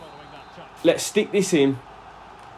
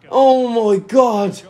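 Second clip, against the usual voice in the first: stunned disbelief; 8 semitones higher, 4 dB louder, much slower than usual. Real, not acted. Reacting to a wild shot.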